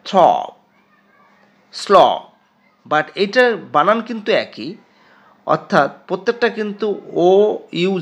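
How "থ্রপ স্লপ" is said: থ্রপ and স্লপ said loud, one by one; pronunciation drill; model words. Shown as a demonstration. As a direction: repeat থ্রপ, স্লপ clearly. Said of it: "যেমনটা এটা হয়েছে".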